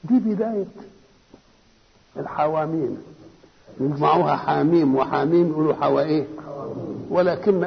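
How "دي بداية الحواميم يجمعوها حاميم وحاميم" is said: ordinary delivery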